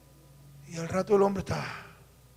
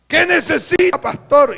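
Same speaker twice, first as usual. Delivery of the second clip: higher, louder pushy question; eager loud offer; 6 semitones higher, 8 dB louder than usual. Retold or imitated. Rich man pressing the pastor, higher loud eager question. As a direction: push your offer on the pastor.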